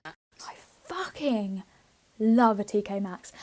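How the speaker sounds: emphatic whispered "fucking" before the brand name; stress on fucking. Shown as a demonstration. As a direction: lean hard on "fucking" with a hushed drawn-out hiss. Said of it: "did deserve the emphasis on fucking".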